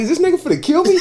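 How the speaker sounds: high, terrified question; panicked. Retold or imitated. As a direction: ask it in real fear. Retold fear while choked.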